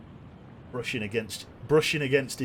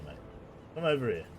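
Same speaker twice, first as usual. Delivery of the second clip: ordinary talk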